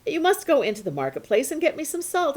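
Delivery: lightly voiced mother asking for salt; mother's voice.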